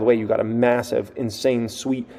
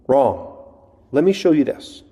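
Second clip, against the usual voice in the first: normal talking voice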